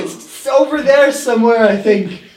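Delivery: high loud panicked shouting; panicked yelling. Acted. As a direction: yell in mock panic. Acted skit, leg stolen.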